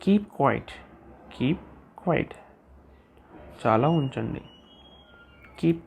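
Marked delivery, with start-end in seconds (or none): none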